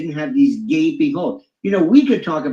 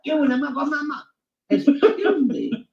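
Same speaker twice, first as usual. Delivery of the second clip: a hint of an indignant Karen, higher; imitating Karen; 5 semitones higher than usual. Higher indignant Karen mimicked while she is arrested. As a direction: mimic an arrested woman demanding her phone. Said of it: "Karen's arrested".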